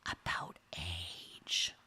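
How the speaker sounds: hushed, breathy aside; whispering a taboo. Shown as a demonstration. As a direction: drop to a secret whisper. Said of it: "And then there's the whisper".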